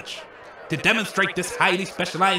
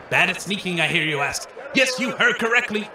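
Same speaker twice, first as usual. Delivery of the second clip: usual speaking voice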